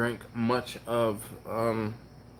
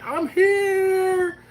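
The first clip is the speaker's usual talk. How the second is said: high, loud mock shout of Preston barging in; mock late arrival; 5 dB louder than usual. Ribbing Preston for showing up late, a high mock shout. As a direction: yell it like the latecomer bursting in. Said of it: "And then you be like".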